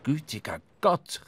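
surprised exclamation. Acted. English captain startled by Ahab's question: surprised exclamation. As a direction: blurt it out in shock. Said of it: "rief überrascht der englische Kapitän".

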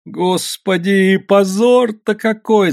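high, slow, drawn-out wail; tearful lament. A narrator's reading. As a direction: weep over the public shame. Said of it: "заплакала Анютка".

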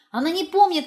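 ordinary delivery, nothing marked

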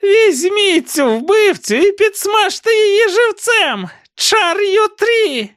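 very high, slow witch's screech; Rhea screeching. A narrator's reading. Witch urging the crowd to seize the girl, cackling screech. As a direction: shriek the order like a crone. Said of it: "заверещала Рея".

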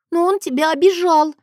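put-on high, plaintive little girl; objecting child. A narrator's reading. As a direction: protest as a hurt little sister. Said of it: "возразила она". (детское возражение, высоко)

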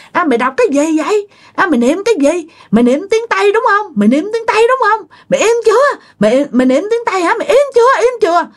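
very high, rapid-fire ghostly nagging; noisy scolding. Acted. Ghosts interrupt her Buddhist chanting, high furious scolding. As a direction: berate in a pinched-high pitch, building to curt commands. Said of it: "chửi um sùm".

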